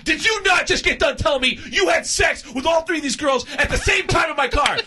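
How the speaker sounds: high, heated retold accusation; indignant confrontation. Jay confronts him, retold quarrel.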